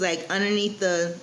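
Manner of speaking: ordinary delivery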